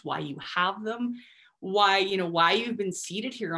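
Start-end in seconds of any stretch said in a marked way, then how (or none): none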